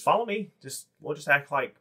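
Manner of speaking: a small goblin acting confident and tall; goblin bravado. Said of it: "He says".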